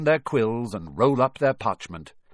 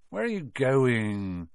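slow, drowsy question; sleepy; 2 semitones higher, slower than usual. Acted. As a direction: ask it half asleep. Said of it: "said Ron sleepily".

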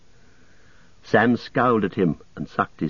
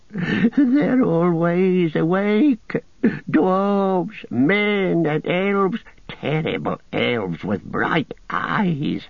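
wailing, much higher, slow; sobbing in his throat; 8 semitones higher, much slower than usual. A narrator's reading. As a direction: Gollum breaking down, moaning. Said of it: "he sobbed in his throat".

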